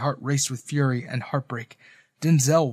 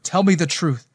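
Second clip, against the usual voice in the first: ordinary delivery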